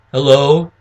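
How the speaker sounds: low-pitched phone hello; low-key prospect. Shown as a demonstration. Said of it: "mirror the prospect's mannerism".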